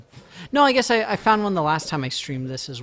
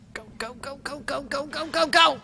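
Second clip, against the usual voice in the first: urgent go go go; 11 semitones higher than usual. Real, not acted. Streamer fleeing an attack in the game, building urgent chant. Urging himself while under attack.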